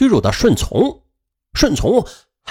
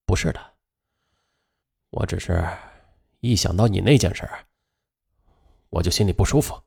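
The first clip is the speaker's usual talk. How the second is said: low, quiet and calm; 7 semitones lower, 6 dB quieter than usual. A narrator's reading. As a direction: say it flatly and calmly. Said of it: "刘鹏却平静地对他说".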